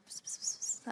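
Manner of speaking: very soft mimicked psst; secretive psst. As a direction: imitate whispering to someone nearby. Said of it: "he looks at Ross and he's like".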